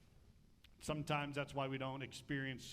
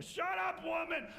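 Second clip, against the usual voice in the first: much louder and higher, husband barking back; angry shout; 13 semitones higher, 6 dB louder than usual. Retold or imitated. Achan shouting down his nagging wife, loud. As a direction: snap at her to shut up.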